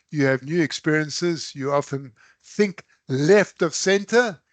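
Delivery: ordinary delivery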